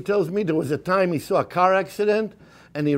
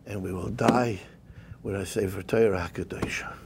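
whisper; 7 semitones lower, 6 dB quieter than usual. Acted. Vow to die with the Torah.

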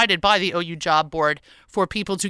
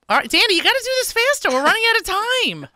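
high hurried urging; 12 semitones higher than usual. Real, not acted. Urging co-host to hurry.

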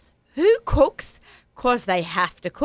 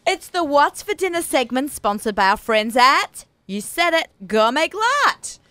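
slow, higher sponsor read with a big finish; sponsor announcement; 5 semitones higher, 4 dB louder, slower than usual. Acted. Host introduces the dinner segment's sponsor, drawn-out announcer delivery. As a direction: announce the sponsor loudly, drawing out the name.

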